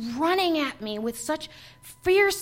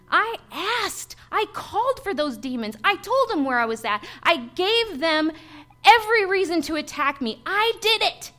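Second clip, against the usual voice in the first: building emphasis; 5 semitones higher, 5 dB louder than usual. Real, not acted. Building self-accusation to congregation.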